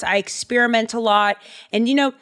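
normal talking voice

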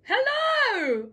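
very high, loud squeal of welcome; shrieky, hammed-up hello; gushing greeting. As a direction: greet a friend with over-the-top delight. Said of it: "So I turn around like".

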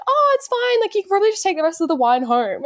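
very high, over-polite brush-off; sweetly wriggle out of an awkward date; politely breezy. Retold or imitated. Acts politely escaping an awkward date.

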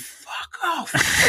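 high, strained re-enacted scream; retold scream. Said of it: "I literally screamed".

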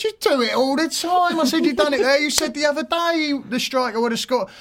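high, insistent accusation; exasperated high-pitched comeback; retold argument. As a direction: throw his own words back at him. Retold row with manager.

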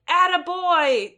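high, loud, slow drawn-out cheer; crowd yell. Retold or imitated. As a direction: belt it like a fan in the stands. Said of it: "repeatedly yelling out".

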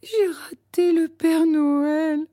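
put-on high, slow, tearful little girl; a child's sob of disappointment; sobbing. A narrator's reading. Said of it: "sanglote-t-elle".

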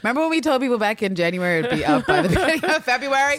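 laughing, fast and loud. Real, not acted. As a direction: laugh through the excuse. Joking about late launch.

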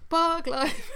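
high, loud chant of [name]; shouting [name]. Retold or imitated. Podcast host imitating the [name] shout, loud and high. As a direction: belt it like the song's crowd chorus. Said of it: "I just went to shout".

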